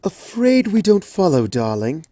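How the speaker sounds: refined, puzzled lady voice; genteel Rarity. Acted. Rarity gently puzzled by Twilight, refined lady voice. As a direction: lift it gracefully, then drop on darling.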